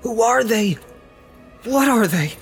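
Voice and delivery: high-pitched, frightened questions; Sam shuddering. A narrator's reading. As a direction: ask with a shudder, voice pitched right up.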